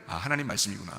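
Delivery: low, softened moment of acceptance; quiet realization. Acted. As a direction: softly take the warning to heart. Acting quiet acceptance of the warning. (낮고 조용하게 깨닫는 말투)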